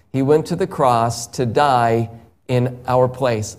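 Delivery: ordinary delivery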